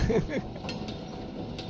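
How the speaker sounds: high breathy giggle. Real, not acted. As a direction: burst into a stifled giggle. Laughing at chat.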